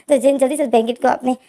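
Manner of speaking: plain reading voice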